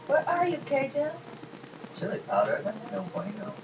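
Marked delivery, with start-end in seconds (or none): 0.1-1.1 high, quiet sing-song call to a lost spice jar; playful calling out. Pretend. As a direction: coax the jar out like calling a pet. Searching the spice cabinet.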